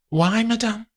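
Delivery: high, confused; high, uneasy; puzzled maid. Acted. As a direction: ask why, unsure.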